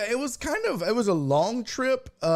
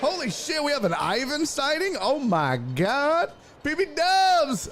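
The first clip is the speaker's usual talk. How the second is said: excited; 8 semitones higher than usual. Real, not acted. Excited by returning viewers.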